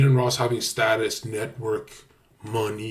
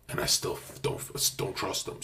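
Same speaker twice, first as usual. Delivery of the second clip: whispering; 4 semitones lower, 9 dB quieter than usual. Real, not acted. Confiding aside.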